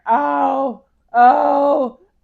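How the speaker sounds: repeated high, loud groans, re-enacted; acted cries of pain; pained moaning. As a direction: moan as each wave of pain hits. Re-enacts lying there in pain.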